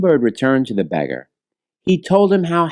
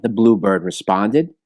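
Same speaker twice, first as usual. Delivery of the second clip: ordinary delivery, nothing marked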